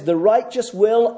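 "will" leaned on and held as a promise; stress on WILL. Shown as a demonstration. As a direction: press down on "will" before the pause. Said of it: "emphasis mine".

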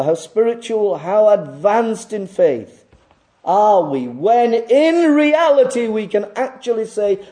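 high, loud pulpit proclamation; rising fervent sermon voice; full-force preaching. Acted. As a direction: preach it out to the whole congregation.

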